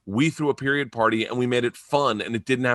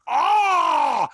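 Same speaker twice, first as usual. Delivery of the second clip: long, high exasperated "ah"; mock scream of frustration, held out; exasperated yell; 4 dB louder than usual. Comedian reacting to friend's advice, exasperated yell.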